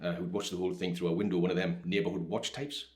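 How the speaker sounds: ordinary talk, nothing marked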